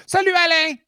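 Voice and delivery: very high squeaky French greeting; high-pitched cartoon French; mock French. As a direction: sing out a silly French hello. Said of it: "in a dumb French voice".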